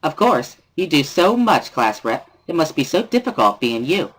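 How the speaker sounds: high, sweet sarcastic voice; mock praise. Misha mocking the class rep with sugary sarcasm. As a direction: lay the flattery on thick.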